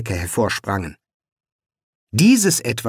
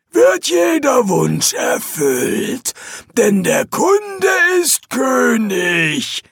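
high, slow, shouted demon recital; unmoved demon; 10 semitones higher, slower than usual. Acted. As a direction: plough on regardless, bellowing. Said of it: "fuhr der Dämon ungerührt fort".

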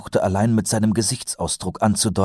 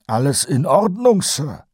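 much higher, louder repeat of the question; 10 semitones higher, 4 dB louder than usual. A narrator's reading. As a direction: ask again, pitching up to get his attention. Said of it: "wiederholte Kolon". (deutlich höher und lauter wiederholt)

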